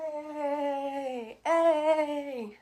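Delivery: long drawn-out, buoyant hey; upbeat greeting. Real, not acted. Vlogger back after being sick, upbeat. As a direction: call out a big, happy hey. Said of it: "I'm feeling really good right now".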